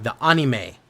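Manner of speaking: ANIME corrected loudly with open "ah", AH-nee-may; girlfriend's correction. Retold or imitated. Girlfriend correcting his slip, ANIME loud with an open "ah". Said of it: "She's like".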